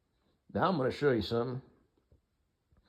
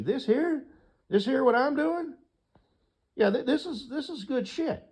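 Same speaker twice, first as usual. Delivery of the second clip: excited; 5 dB louder than usual. Real, not acted. Excited boast about own habit.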